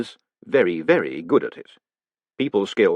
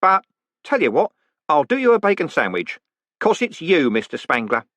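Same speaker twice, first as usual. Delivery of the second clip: high, bright-voiced jolly warder; cheerful warder; 7 semitones higher, 3 dB louder than usual. Warder reporting the prisoner's words to the condemned man, cheerfully. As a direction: play the polite prison warder chatting brightly.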